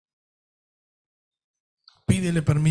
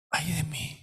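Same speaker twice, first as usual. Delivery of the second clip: hushed lament; 13 dB quieter than usual. Acted. Praying confession ends in lament.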